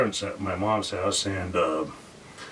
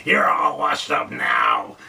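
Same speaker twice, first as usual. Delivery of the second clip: slow, loud, higher gloating taunt; gloating villain; 5 semitones higher, 5 dB louder, slower than usual. Retold or imitated. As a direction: drag it out, savouring the insult. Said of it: "he says".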